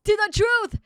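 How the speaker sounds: loud heroic toast; rallying shout. Acted. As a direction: call it out high and proud. Voicing game character toast.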